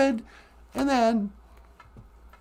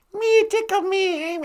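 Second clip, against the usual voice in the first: a failed puppet voice, half-hearted; lightly voiced Elmo; bad Elmo voice; 5 semitones higher than usual. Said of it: "I can't even do a Tickle Me Elmo voice".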